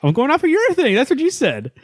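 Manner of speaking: a high squeaky mock protest; jokey high-voiced complaint to Garrett; mock indignant squeak.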